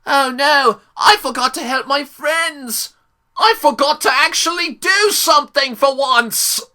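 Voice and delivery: high, loud hammed-up cartoon wail; over-the-top mock panic; mock sudden realisation. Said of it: "then he comes to realize what he's doing".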